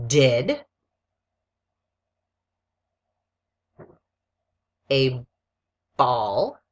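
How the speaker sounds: very slow word-by-word dictation. Shown as a demonstration. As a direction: dictate slowly, one word at a time with long pauses. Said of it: "read it again a second time slowly".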